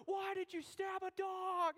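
high, drawn-out wail; slow, high-pitched whine of disbelief; hammed-up indignant cry; mock anguished protest. Acted protest after being stabbed.

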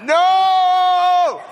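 long, high, drawn-out yell; screamed inner protest. Acted. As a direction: scream it out on one held note. Acting out his inward scream.

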